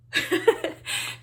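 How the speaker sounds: high breathy sigh through tears; shaky sigh. Real, not acted. Vlogger composing herself, tearful sigh. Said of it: "I need to get it together".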